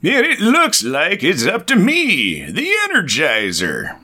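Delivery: slow, put-on heroic declaration, starting high; hammy kid superhero. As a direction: announce yourself as the comic-book hero. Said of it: "Jack, it's time to hero up".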